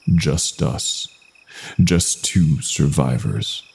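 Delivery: plain reading voice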